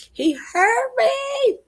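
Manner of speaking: high, slow, crying shout; crying wail. Retold or imitated. Telling her therapist about the breakup, crying out. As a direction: wail it like a hurt cry.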